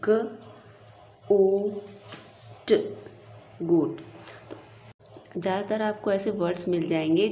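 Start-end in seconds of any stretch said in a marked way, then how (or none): 0.0-2.7 ग, ओ, ट spoken as separate sounds; teacher sounding out a word letter by letter; sounding out goat. Shown as a demonstration. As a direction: break गोट into its three sounds, one at a time. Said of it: "तो बच्चा लिखेगा".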